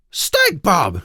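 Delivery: loud alarmed call of his name; cried out. Acted. Sister crying out to wake her brother. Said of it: "she cried".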